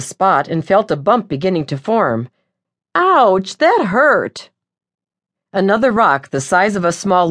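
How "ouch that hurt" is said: pained ouch. A narrator's reading. Hit on head by rock.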